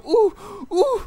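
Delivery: high, loud anxious groans; tense gasps. Retold or imitated. As the shot hits the post, he lets out high, loud groans. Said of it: "y yo".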